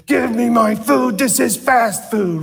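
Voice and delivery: a hammed-up annoyed diner at the drive-thru; imitating a frustrated customer. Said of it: "aggravated and frustrated going".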